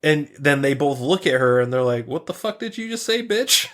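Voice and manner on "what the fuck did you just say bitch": high, fast incredulous outburst; mock shocked what-the-hell, pitched up high. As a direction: blurt it out in disbelief. Rick and Morty react, retold.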